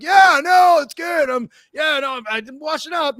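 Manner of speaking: high, loud fake-casual shout; strained high cover-up, acted out; calling back, retold. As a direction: shout through the door that all is fine.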